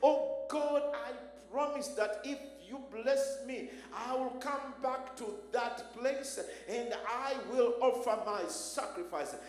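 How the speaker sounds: loud, urgent cries to God, pushing every phrase; impassioned loud prayer. Acted. Jacob begging God for mercy, in a loud impassioned prayer. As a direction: preach it as a desperate plea for mercy. Preaching at full force, voicing Jacob.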